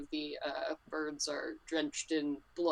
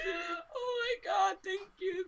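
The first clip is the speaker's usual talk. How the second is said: high sobbing cry of thanks; slower than usual. Acted. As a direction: wail it slowly through tears, much higher. Ronnie cries out in thanks.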